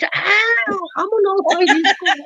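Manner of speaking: high falsetto pleading; high mock woman's voice; mock girl's voice.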